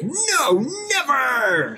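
high, drawn-out mock protest; mock outraged refusal. Imagined rival fans refusing to enter.

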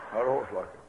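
put-on the old trail hand, unbothered and low; laconic old cowboy. Old cowboy telling the preacher nobody knows, low and quiet.

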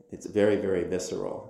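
ordinary talk, nothing marked

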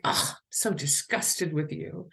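acted-out disgust at a partner. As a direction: groan of disgust, then contempt. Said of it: "not of disgust, like".

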